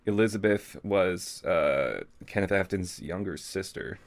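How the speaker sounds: ordinary talk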